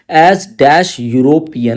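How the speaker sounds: very slow, word by word; slow question read. Shown as a demonstration. Teacher reads the exam sentence to students, very slowly.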